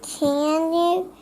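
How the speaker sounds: usual speaking voice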